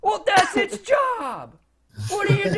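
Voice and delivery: high, loud, indignant retort; outraged wizard defending his disposal; exasperated wizard shout. Acted. Wizard snaps back at intruders.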